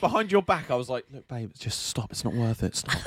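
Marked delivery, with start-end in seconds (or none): 1.1-3.0 soft calming. Retold or imitated. Calming partner back from fight.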